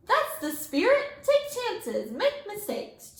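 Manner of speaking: high, bright encouraging cheer; beaming. A narrator's reading. Teacher encouraging her class, high beaming voice. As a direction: cheer them on with delight. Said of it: "Miss Frizzle beamed".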